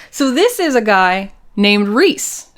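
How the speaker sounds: ordinary talk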